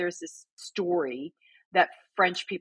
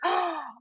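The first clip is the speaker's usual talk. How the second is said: mock delighted gasp; 11 semitones higher, 3 dB quieter than usual. Delighted at being corrected.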